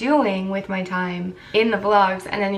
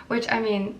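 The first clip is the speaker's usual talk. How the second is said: ordinary talk, nothing marked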